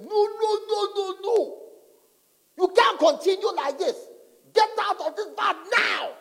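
loud, high, alarmed self-scolding; half-comic shout, bro get out; mock shout at himself. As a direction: yell at yourself to get out now.

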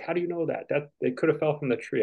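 put-on high, piping kid's questioning voice. Young kids questioning their dad, high-pitched doubt. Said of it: "They're like".

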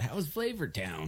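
a light celebrity impression; Guy Fieri. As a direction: toss it out like a TV food host. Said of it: "He said".

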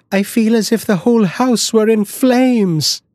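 high, pleading cry; frightened cry. Acted. The wife begs her husband to stay, high frightened cry. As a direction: beg him not to go, afraid.

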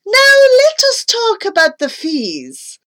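high-pitched, eager announcement; pouncing on the subject of fees; exclaiming dentist. A narrator's reading. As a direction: exclaim it grandly, starting high. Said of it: "I heard the man exclaim".

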